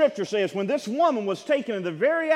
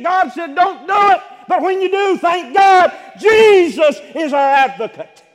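much louder and higher, rising to a shout; impassioned preaching; 7 semitones higher, 8 dB louder than usual. Acted. Preacher testifies to the congregation, building to a shout. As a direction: preach it out with full force.

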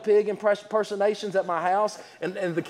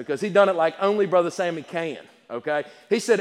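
ordinary talk, nothing marked

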